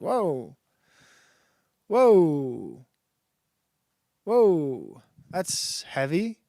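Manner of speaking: slow, drawn-out whoas of disbelief; stunned whoa. Retold or imitated. Reacting to a dark Narnia post, stunned and slow. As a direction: let each whoa land heavier. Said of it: "I was like".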